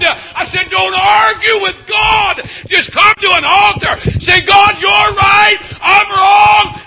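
loud, high, stretched revival preaching; full-force preaching. Acted. Preacher urging sinners to pray, loud and high. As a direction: preach it, pull them to the altar.

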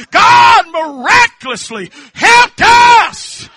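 loud, high, rhythmic preaching cries; full-force preaching. Real, not acted. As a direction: preach it out with fervour.

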